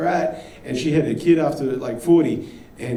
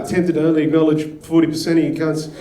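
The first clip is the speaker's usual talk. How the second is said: ordinary talk, nothing marked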